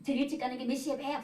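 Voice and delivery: imitated frosty question, quick; mock-curt annoyed wife; cold, curt. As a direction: ask briskly with a cold shoulder. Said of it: "눈길도 안 주고는 돌아서가지고 쌀쌀맞게".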